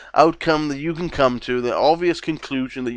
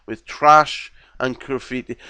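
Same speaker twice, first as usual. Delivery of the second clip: ordinary talk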